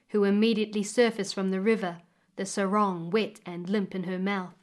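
usual speaking voice

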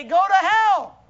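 loud, slowed mock curse; angry retort. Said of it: "and they say".

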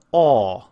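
loud, resonant "aw" through oval lips; rounded aw vowel. Shown as a demonstration. Teacher models the backward C, loud rounded "aw". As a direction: hold a tightly rounded "aw". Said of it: "and the sound".

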